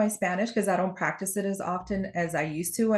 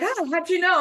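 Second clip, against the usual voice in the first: high-pitched mock astonishment; acted surprise; 11 semitones higher, 4 dB louder than usual. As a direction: imitate someone caught off guard, pitch leaping up. Voices surprised Cubans she identified.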